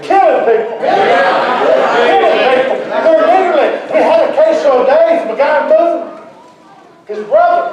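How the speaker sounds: loud, high-pitched sermon delivery; shouting preacher in full flow; fired-up preaching. Real, not acted. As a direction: preach it at full force to the congregation.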